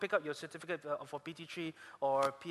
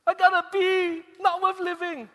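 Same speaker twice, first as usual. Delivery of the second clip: much higher, louder melodramatic wail; mock despair; 13 semitones higher, 12 dB louder than usual. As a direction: ham up the tragedy of a B. Mocking friend's despair over B.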